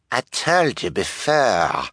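slow, put-on oily detective voice; smug fake detective. A narrator's reading. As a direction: draw it out smugly. Disguised detective answers smugly.

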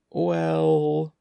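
stall, holding the word on a sustained pitch; reluctant, hesitating; hesitant. A narrator's reading. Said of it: "Andy hesitated".

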